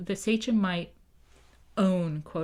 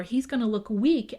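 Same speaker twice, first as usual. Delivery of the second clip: ordinary delivery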